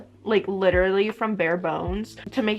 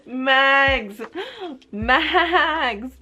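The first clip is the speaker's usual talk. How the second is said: excited cry; 5 semitones higher, 4 dB louder than usual. Real, not acted. Recognises the beloved character.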